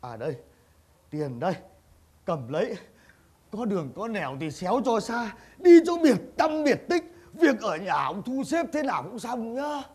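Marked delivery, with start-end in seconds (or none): 0.0-5.3 much lower, hushed voice; paying him off in a low voice; low, secret handoff. Acted. Master slips money secretly.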